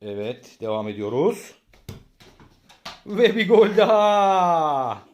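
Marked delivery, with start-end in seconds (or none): 3.1-5.0 slow, drawn-out, high goal call; screaming football commentator. Acted. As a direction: stretch the goal call out loud. Dad commentating home football.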